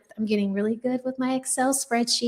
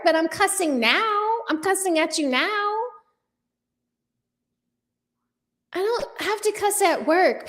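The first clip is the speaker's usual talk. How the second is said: mock scolding; 7 semitones higher than usual. Real, not acted. Answering a commenter who asked about profanity, indignant. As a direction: tell off the troll, half amused.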